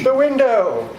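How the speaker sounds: sharp high alarmed shout; a sudden high cry of alarm; startled cry. Acted. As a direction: cry out as something bursts in. Sudden alarm as Dracula appears.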